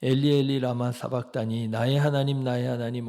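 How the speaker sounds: Jesus crying out, loud and desperate; anguished cry. Pretend. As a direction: call out to God from the cross.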